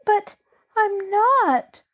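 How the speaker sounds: put-on girl finally making herself heard. A narrator's reading. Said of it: "loudly enough to be heard".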